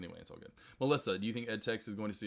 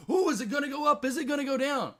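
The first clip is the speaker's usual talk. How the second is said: high, loud fretting whine; mock nervous trader; 7 dB louder than usual. Streamer mocking the anxious watching of a stock, high and loud. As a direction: ham up a worried trader. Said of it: "I didn't have to".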